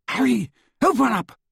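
high, alarmed calling through a door; urgent shout. A narrator's reading. Hermione pounding on the locked door, shouting urgently. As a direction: call out high and insistent.

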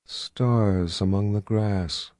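slow, soft, lifeless monotone; dead voice. Acted. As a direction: drone it out like a trance. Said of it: "in that same dead voice".

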